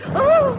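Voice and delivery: high, loud cry of fright; a sudden yelp of alarm; startled cry. Acted. Aunt cries out as thunder crashes, startled yelp.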